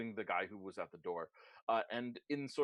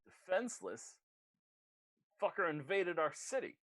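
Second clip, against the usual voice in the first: higher-pitched defensive outrage; indignant retort; 7 semitones higher than usual. Acted. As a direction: protest as the accused thug. Accused character retorts indignantly.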